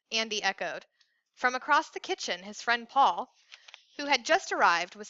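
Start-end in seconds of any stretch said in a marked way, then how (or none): none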